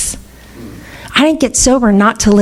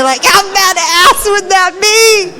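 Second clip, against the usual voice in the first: excited; 11 semitones higher than usual. Real, not acted. Laughing excited rhetorical question.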